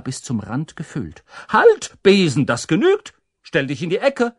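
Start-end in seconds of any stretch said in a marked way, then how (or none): 1.5-4.3 loud, raised-pitch command; calling out. A narrator's reading. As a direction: order it sharply to stop. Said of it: "rief Humboldt".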